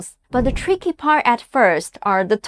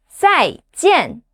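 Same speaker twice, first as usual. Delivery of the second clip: "Zaijian," each syllable dropping sharply; fourth tone; 3 semitones higher than usual. Shown as a demonstration. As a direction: drop both syllables of "Zaijian," fast. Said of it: "The fourth tone drops fast".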